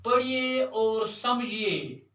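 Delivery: each word drawn out slowly for the class; slow dictation. Shown as a demonstration. Teacher to schoolchildren, dictating the heading slowly. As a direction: spell it out slowly so children can write.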